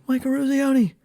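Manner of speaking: imitated yell, very high pitched; Rizzo. Player unexpectedly yells out his name, imitated high yell. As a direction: call out the name in a high mock shout. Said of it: "Rizzo yells out".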